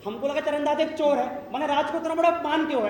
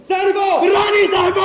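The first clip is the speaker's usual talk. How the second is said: shouting; 7 semitones higher, 10 dB louder than usual. Acted. Minister shouts orders.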